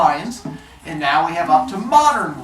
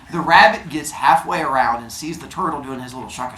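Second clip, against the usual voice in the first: plain reading voice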